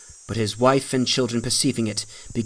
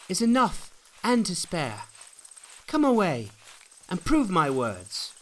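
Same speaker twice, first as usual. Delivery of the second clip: higher, pleading pilgrim voice; 7 semitones higher, 4 dB quieter than usual. A narrator's reading. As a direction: urge the neighbours to come along.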